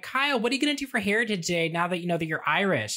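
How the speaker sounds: Bonnie's question, quick and lightly put on; quoting Bonnie. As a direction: rattle it off as a pushy girl. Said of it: "Bonnie walks into the gym and she's like".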